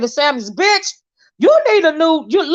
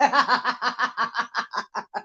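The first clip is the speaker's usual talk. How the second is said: long breathy laughing fit; cackling laughter at the monkeypox line; real laughter; 9 dB quieter than usual. Real, not acted. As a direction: laugh hard at your own joke. Laughing at own joke.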